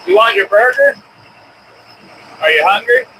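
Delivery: usual speaking voice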